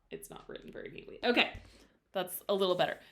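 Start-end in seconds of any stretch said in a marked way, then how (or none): 0.1-1.2 muttering. Real, not acted. Muttered self-correction at board.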